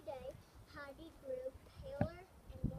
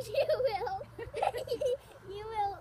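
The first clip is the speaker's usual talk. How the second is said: loud, high, laughing read; louder on request; 6 semitones higher, 13 dB louder, slower than usual. Shown as a demonstration. Child reading Heidi's line louder because parent asked. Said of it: "You can say some louder".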